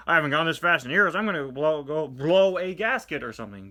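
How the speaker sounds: loud, fast panicked rush; mock panic. McQueen speeding away from the sheriff, loud and rushed. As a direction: blurt it out in a frantic hurry.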